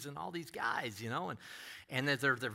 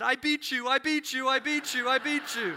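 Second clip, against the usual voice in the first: high, loud gloating chant of I beat you; crowing triumph at the loser; gloating taunt; 12 semitones higher, 8 dB louder than usual. Retold or imitated. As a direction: rub it in, singsong and loud. Wolfgang gloating over the score.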